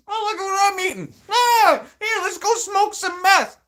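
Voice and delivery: loud, very high-pitched crazed squeal; hammed-up frantic shrieking, way up high; mock manic addict. Playing the meth head they were called, manic squeal.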